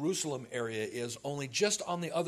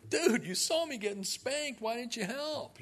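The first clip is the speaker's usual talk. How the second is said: mock complaining; 9 semitones higher than usual. Retold high school friends complaining.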